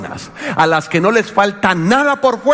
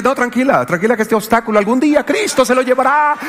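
sarcastic reassurance; 6 semitones higher than usual. Retold or imitated. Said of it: "Le dije".

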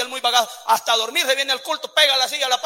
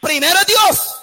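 loud, slow hammering refrain; preacher driving home God first; full-force preaching; 4 semitones higher, 7 dB louder, slower than usual. Acted. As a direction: pound each phrase at the congregation. Preaching climax, repeated refrain.

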